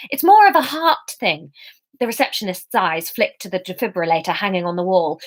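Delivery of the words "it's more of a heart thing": flustered. A narrator's reading. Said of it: "starting to panic".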